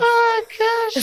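high, loud gasp of shock; stunned reaction. Real, not acted.

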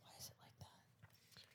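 barely audible retold whisper. Host retelling her reply to a friend, whispered. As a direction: drop right down into a whisper.

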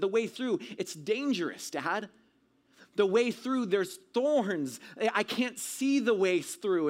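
high, pleading objection; alarmed protest. Retold or imitated. Scared boy objecting to his dad's advice, alarmed protest. Retold protest to dad about danger.

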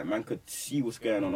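ordinary talk

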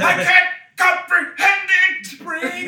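high, slow, loud mock panic shout; hammed-up scientist. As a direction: wail it like a melodramatic professor. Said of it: "he said".

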